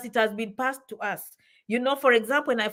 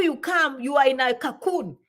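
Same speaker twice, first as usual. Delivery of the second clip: normal talking voice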